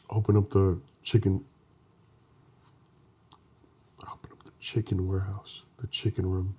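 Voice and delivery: hushed, hammed-up tragic storytelling; mock sad story. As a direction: tell it like a fake tearjerker, quiet and heavy. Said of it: "Give me the sad one".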